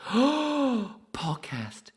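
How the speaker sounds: high, loud gasp of delight. Pretend. Streamer reading chat names, high loud gasp. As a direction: gush over the name.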